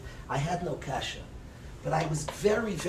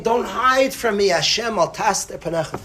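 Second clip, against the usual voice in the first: raised, pleading cry to God; 4 semitones higher, 7 dB louder than usual. Retold or imitated. David HaMelech crying out to Hashem, raised plea. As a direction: cry out, begging not to be hidden from.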